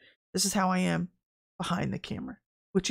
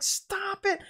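high-pitched retold protest; mock-pleading high voice; exasperated plea; 12 semitones higher, 4 dB quieter than usual. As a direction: exclaim it high, without raising the volume. Retold exasperated scolding of showy people.

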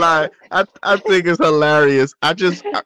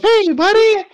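much higher, squeaky little-voice greeting; high cartoon voice; 4 dB louder, much slower than usual. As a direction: chirp it like a tiny cartoon critter. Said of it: "She is a".

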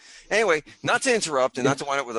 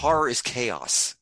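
slow pronouncement; much slower than usual. Real, not acted. Pronouncing a motto.

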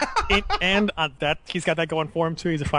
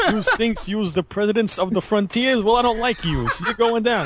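mobster voice; 8 semitones higher than usual. Pretend. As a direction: growl out threats like a cartoon mob boss. Scorsese mob hit parody.

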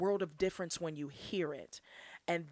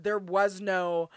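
usual speaking voice

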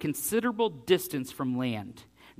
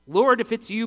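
calling out to Jesus; 6 semitones higher, 6 dB louder than usual. Retold or imitated.

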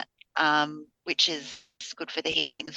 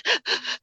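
quick unvoiced gasp for air, much higher; sudden sharp inhale like mid-sob; sharp crying gasp. Shown as a demonstration. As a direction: demonstrate the catch of breath in a sob. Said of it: "you have that sort of".

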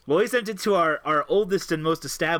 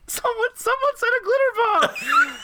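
high, slow flustered secretary; 5 dB louder than usual. Retold or imitated. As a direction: squeak it out like a rattled secretary. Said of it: "then had to report and say".

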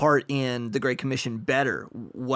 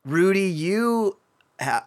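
ordinary delivery

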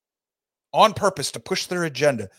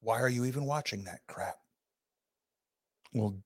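ordinary delivery

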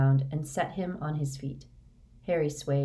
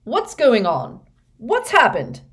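high, loud demanding questions; alarmed shout; 7 semitones higher, 11 dB louder than usual. A narrator's reading. Official arrives in a panic.

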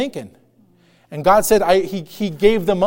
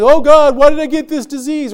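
higher, louder wail of complaint to God; mock lament; 5 semitones higher, 6 dB louder than usual. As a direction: plead with God in mock dismay.

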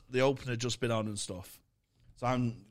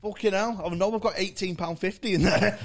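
mock exasperation; 8 semitones higher, 7 dB louder than usual. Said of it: "I went".

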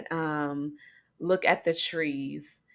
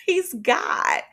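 laughing; 5 dB louder than usual. Real, not acted. Laughing at her own point.

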